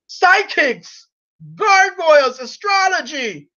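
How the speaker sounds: high-pitched imitation of screamed denunciations; mock screaming. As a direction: shout each word separately, mimicking her rant. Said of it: "and starts screaming".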